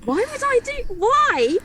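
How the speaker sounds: very high, shocked disbelief; incredulous. Retold or imitated. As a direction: squeak out the disbelief. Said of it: "I was like".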